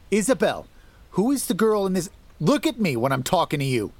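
angry husband calling after his wife; demanding shout. A narrator's reading. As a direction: shout at her to face you. Husband chasing, demanding answers.

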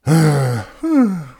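long admiring sigh; loud sigh. Acted. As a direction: sigh it out in awe. Both let out a sigh.

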